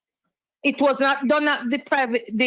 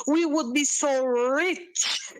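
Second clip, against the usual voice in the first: ordinary delivery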